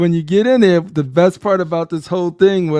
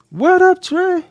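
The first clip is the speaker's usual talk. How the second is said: much higher, hyped shout-out; squealed high greeting; 14 semitones higher, slower than usual. Real, not acted. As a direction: call out to a friend, pumped. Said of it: "greeting repeated".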